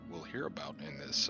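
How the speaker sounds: usual speaking voice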